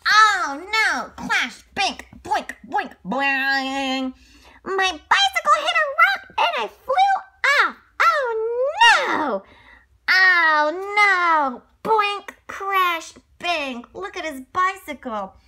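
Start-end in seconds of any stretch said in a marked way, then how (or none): 0.1-1.1 alarm. Acted. Bike about to crash. 1.3-4.1 crash noises. Acted. Crash sound effects, playing. 4.6-9.4 high, loud panicked cry; panicked telling. Acted. Crash told in panic. 10.1-11.6 loud, high wail of dismay. Acted. After the crash. 11.8-13.8 crash noises. Acted. Crash sound effects, playing.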